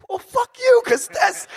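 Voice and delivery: loud, very high wailing outburst; tearful mock yell. Two singers trading emotional compliments, imitated tearful yelling. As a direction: yell it as if choking up. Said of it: "both of us in tears".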